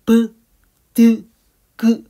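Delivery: 브, 드, 그 each popped out loud, short and sharp; plosive sounds demo. Shown as a demonstration. Teacher bursting 브, 드, 그 for the class, loud and sharp. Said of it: "공기가 딱 막혔다가 팍 터지는 느낌".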